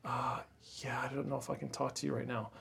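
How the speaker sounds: a hushed, fragile voice like she's holding back tears; teary near-whisper. Retold or imitated. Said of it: "She's kinda crying".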